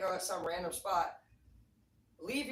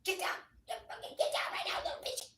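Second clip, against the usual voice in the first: yelling at the dog; 11 semitones higher than usual. Retold or imitated. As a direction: bark orders at a jumping dog. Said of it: "we're like".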